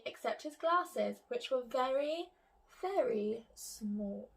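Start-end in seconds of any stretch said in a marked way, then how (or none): 1.7-4.3 very small, drawn out slowly; stress on very small. A narrator's reading. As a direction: stretch "very, very small" to show tininess. Reading emphasis, slowed small.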